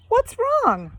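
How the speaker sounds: high alarmed question; worried cry. Acted. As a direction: call out in a worried rush. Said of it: "cried Daphne".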